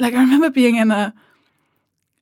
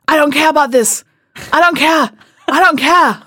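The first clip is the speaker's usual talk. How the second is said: higher, louder insistent mock shouting; mock angry commenter; 5 semitones higher, 6 dB louder than usual. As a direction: hammer I don't care like a troll. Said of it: "comments that are like".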